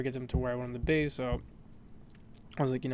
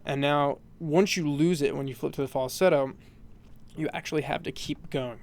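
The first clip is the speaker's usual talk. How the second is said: normal talking voice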